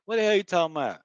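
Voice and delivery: high, slurred Florida question; Florida accent. Shown as a demonstration. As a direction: swallow "talking about" the Florida way. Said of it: "That's how we do it down the way".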